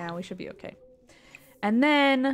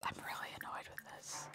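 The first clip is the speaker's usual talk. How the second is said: softer whispered grumble; annoyed whisper; 21 dB quieter than usual. Real, not acted. Streamer whispering annoyance at the game. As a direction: breathe it out, barely voiced.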